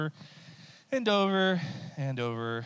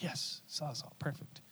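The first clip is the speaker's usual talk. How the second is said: excited under breath; 2 semitones higher, 12 dB quieter than usual. Retold or imitated. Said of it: "I was like".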